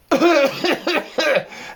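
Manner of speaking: put-on high, rasping old ewe's cough; sheep's cough. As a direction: hack out a cough like a sick sheep. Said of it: "She coughed".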